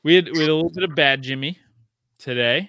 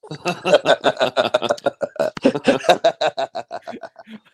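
burst of laughter; 6 dB louder than usual. Real, not acted. Laughing at his own story.

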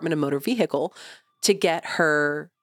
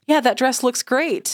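higher, mock-complimentary tone; 6 semitones higher than usual. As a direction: lift the pitch into a polite fib.

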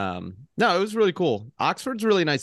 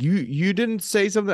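put-on drunk guy quoted; 8 semitones higher than usual. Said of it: "He's like".

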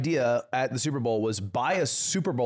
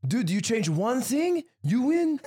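higher, slower, smug imitation of a know-it-all rival; lightly voiced, slow and higher, like talking down to a loser; mocking Etoile's advice; 10 semitones higher, slower than usual. Imitating Etoile lecturing him after a loss, mock smug and slow.

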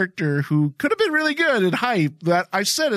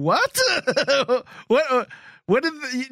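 higher, startled what; mock confusion; 6 semitones higher than usual. Fan recalling his reaction to the scene, higher baffled voice. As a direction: act baffled and lost for words. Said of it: "And I was like, going".

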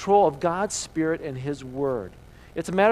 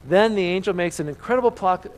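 ordinary talk, nothing marked

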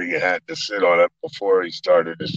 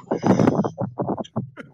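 a squealing, high-pitched laugh; real high giggle of disbelief; delighted high laugh. Real, not acted. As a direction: burst out laughing at the memory. Laugh after the Pacino story.

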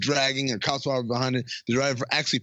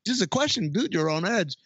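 high, fast mock backing off; nervous backpedal; 12 semitones higher than usual. Guys calming the touchy driver, high fast backpedal. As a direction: squeak out a quick retreat.

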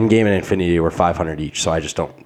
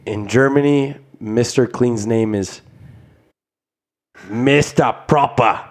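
slow, drawn-out mock German; fake German accent; 3 semitones higher, slower than usual. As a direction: say it like a hammy German. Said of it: "what accent are you doing right now".

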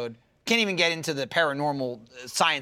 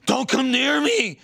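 higher, slow warning cry; mock panicked warning; 7 semitones higher, 4 dB louder, slower than usual. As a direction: plead them to stay away. Personage waarschuwt paniekerig.

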